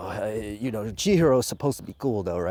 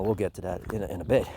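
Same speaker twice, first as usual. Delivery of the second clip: normal talking voice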